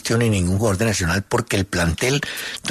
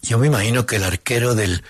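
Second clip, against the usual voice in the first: ordinary delivery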